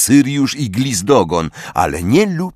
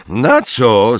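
ordinary delivery, nothing marked

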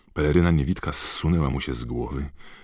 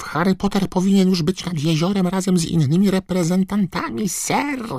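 very high, squeaky elf urging; squeaking house-elf; 6 dB louder than usual. A narrator's reading. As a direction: squeal at him to hurry. Dobby urging Harry. (piskliwy, ponaglający głos skrzata)

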